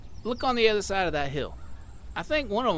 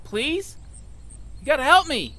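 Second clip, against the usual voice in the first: pleading; 10 semitones higher, 5 dB louder than usual. Acted. Hanging man begs for help.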